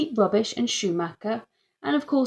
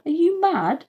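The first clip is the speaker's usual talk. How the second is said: high, outraged disbelief; incredulous at the idea; indignant dad; 10 semitones higher, 4 dB louder than usual. Acted. Dad refuses the inspector's demand, high and outraged.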